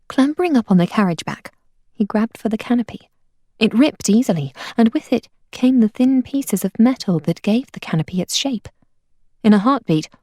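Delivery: plain reading voice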